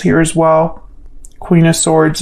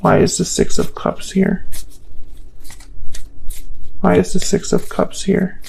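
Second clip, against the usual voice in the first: hushed, breathy question into the deck; whispered question; 3 dB quieter than usual. Real, not acted. Whispering to the cards while she shuffles. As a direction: whisper it to yourself.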